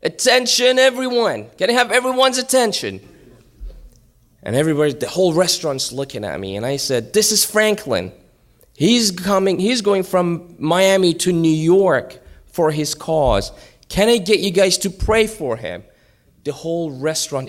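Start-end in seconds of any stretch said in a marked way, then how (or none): 0.0-3.0 high, loud public announcement; re-enacted shout over a crowd; yelling. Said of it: "and I'm yelling". 7.1-15.8 high, loud announcement; raised-voice rallying appeal; addressing a crowd. Retold or imitated. Addressing the whole restaurant from table.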